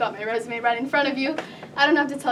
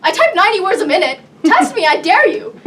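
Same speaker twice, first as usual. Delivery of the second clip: loud, higher, challenging outburst; over-the-top boast; 12 semitones higher, 8 dB louder than usual. Acted. Temp star boasts to interviewer, over the top. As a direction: dare them at full volume. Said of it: "a little over the top".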